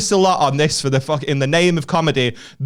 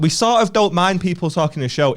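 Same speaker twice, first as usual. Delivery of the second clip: normal talking voice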